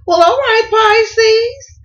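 high, loud cheer; excited cheer. Real, not acted. Celebrating fifteen thousand views.

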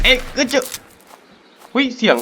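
high, loud startled shouts; sudden alarmed cries; startled yelps. Real, not acted. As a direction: yelp in real surprise at the creature. Startled by animal, shooting.

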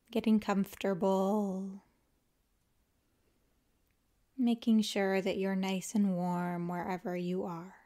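calm, unhurried yoga nidra guidance with long pauses; guided meditation. Acted. Teacher guiding listeners to let the body melt, calm guided meditation. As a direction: ease them into rest, leaving space after each phrase.

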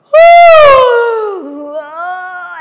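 huge loud yawn; big loud yawn. Acted. Big goat wakes yawning.

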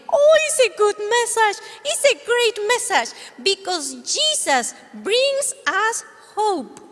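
high, bright little dog voice; puppy puppet. Acted. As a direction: play the eager puppy.

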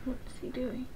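hushed question; quiet aside. Real, not acted. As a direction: murmur it aside.

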